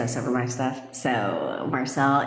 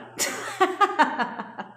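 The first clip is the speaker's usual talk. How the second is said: high, bubbling laugh; real burst of high giggles; amused laugh. Real, not acted. Coach retells a newcomer staying around, high laugh.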